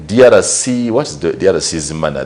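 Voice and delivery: ordinary talk, nothing marked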